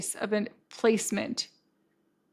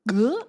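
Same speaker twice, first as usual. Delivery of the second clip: loud guttural "gah" of revulsion; hammed-up shudder of disgust; disgusted noise; 5 dB louder than usual. Picturing him cuddled with his mom, revolted noise.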